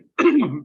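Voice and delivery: sharp, loud throat clear. Real, not acted. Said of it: "Excuse me if I'm coughing".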